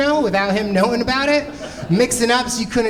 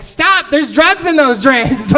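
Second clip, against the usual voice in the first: slow, loud alarmed shout, higher; shouted warning; 6 semitones higher, 5 dB louder, slower than usual. Pretend. Comic imagining yelling a drug warning at the whole club, slow and loud. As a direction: yell it out across the room as a warning.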